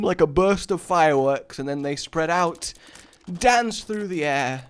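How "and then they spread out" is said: normal talking voice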